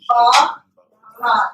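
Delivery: Ba, ra split into two clear syllables with a pause between. Shown as a demonstration. Teacher showing the class the two syllables: Ba, ra, split apart. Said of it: "So you have two vowels".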